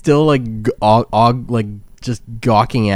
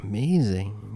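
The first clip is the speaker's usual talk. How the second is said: softer, slow hushed amazement; awed shopkeeper; 4 semitones lower, 11 dB quieter, much slower than usual. Acted. Shopkeeper admiring dwarven paintings, soft and slow. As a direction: breathe it out in slow wonder. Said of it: "He's like".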